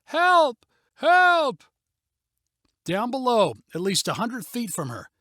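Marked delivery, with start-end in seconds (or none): 0.0-1.6 distant cries for help. A narrator's reading. Family cries from below.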